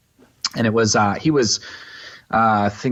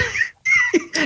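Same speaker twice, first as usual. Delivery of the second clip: high laugh. Real, not acted. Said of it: "He's like".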